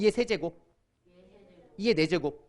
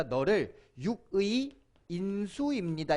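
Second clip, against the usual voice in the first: slow model phrase; slower than usual. Shown as a demonstration. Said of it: "이렇게 부른다".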